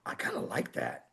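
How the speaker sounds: breathy whisper, pleased; soft whispered company boss; president's hushed voice. Retold or imitated. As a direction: whisper it like a secret approval. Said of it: "And the president goes".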